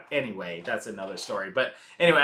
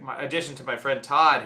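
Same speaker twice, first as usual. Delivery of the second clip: normal talking voice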